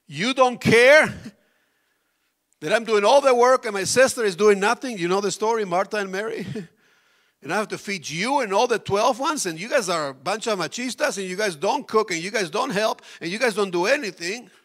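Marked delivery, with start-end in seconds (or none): 0.1-5.0 loud high complaint to Jesus; Martha complaining. Retold or imitated. Martha scolding Jesus about the work, loud complaint. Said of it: "another lady who said the same thing to Jesus". 7.4-14.5 loud high complaint to Jesus; Martha complaining. Retold or imitated. Martha scolding Jesus about the work, loud complaint.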